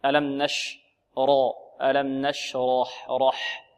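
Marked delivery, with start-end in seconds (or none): 1.8-3.1 slow ألم نشرح; slow model. Shown as a demonstration. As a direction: model it slowly. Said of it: "إذن".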